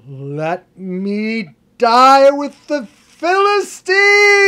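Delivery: full-force, long drawn-out scream, climbing high; huge, high, sustained death cry. A narrator's reading. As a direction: scream it with every word stretched to the limit. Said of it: "He screams out".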